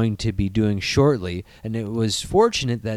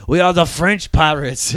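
mock French accent, higher pitched; French pirate; 4 semitones higher, 4 dB louder than usual. Riffing on the French pirate crew, mock French accent. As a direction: play a fancy French pirate. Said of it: "weird French pirate crew".

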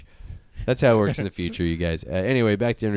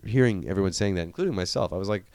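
normal talking voice

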